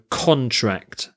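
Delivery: CONtract, first syllable stressed. Shown as a demonstration. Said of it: "it's the first syllable that's stressed".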